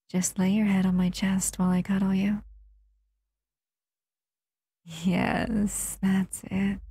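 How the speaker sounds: gentle intimate roleplay voice, close to the mic. Acted.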